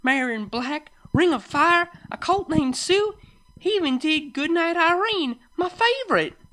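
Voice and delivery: very high, loud gushing list; excited fan. Acted. Farmer listing the songs she loved, excited. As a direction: rattle off favorites, high and thrilled.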